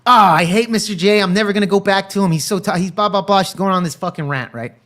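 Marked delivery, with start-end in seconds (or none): none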